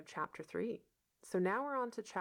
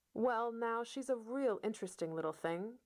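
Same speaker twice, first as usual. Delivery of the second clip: persistent; 6 semitones higher than usual. A narrator's reading. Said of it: "persisted Matthew".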